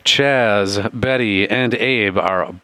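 slow. Shown as a demonstration. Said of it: "Three blind clowns".

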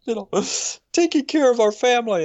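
imitating his wife choked with tears; teary wife. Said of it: "she'd get teared up".

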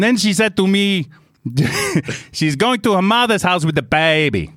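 high, comic mock-Italian narration; aggrieved tale in put-on Italian English; Italian accent. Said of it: "try and do an Italian accent".

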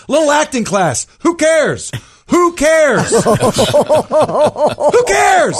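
high-pitched, mocking dismissal; defiant retort. Student answering back to his acting teacher, mock defiance.

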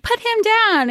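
high, sharp order to put him down; sharp command. Retold or imitated. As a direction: snap the command out. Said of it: "Angelica's like".

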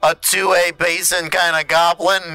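laughing, indignant goblin. Acted. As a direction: scoff like an offended goblin.

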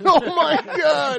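slow, high, laughing hard. Real, not acted. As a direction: crack up laughing. Laughing at the song.

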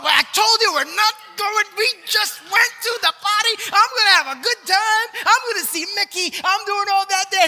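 high put-on whiny dad voice; high-pitched mock complaint to the boy; mock exasperated dad. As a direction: whine back like a fed-up father. Father's exasperated reply voiced.

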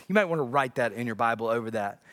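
ordinary talk

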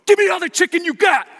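loud, high, frantic order; urgent demand. Retold or imitated. Pastor rushing into the shop for chicken, loud and urgent. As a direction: bark the order like a man in a hurry. Bursting into KFC for food.

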